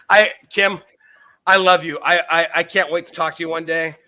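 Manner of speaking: ordinary delivery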